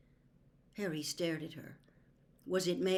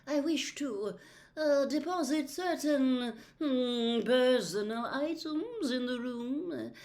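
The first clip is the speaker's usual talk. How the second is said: higher, slow, hesitant and defensive; defensive seer; 7 semitones higher, 3 dB louder, slower than usual. A narrator's reading. Trelawney justifying herself to Harry, defensive and slow. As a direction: hedge every word like a guilty prophetess.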